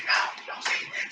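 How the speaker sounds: hushed whisper; a hushed don't-say-it whisper; whispered warning. Retold or imitated. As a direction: whisper it urgently under your breath. Whispered warning in retold scene.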